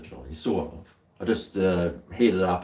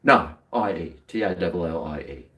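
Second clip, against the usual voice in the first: ordinary delivery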